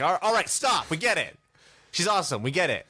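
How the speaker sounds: mock-irritated outburst at hearing it once too often; exasperated. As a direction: cut off the praise, exasperated. Fed up with friends' praise.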